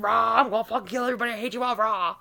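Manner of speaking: hammed-up roaring tantrum; mock raging rant. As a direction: parody your own angry rant, roaring. Imitates own angry Snapchat rants.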